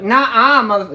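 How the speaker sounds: high, loud, cut-off yell; sassy shout. Retold or imitated. Sassy mother standing up to someone, high and loud. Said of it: "And be like".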